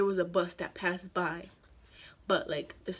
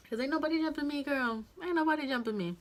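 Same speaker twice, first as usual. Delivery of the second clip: defiant; 6 semitones higher than usual. Real, not acted. Defiant brag to viewers.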